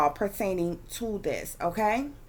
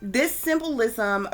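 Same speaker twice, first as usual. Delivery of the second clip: ordinary talk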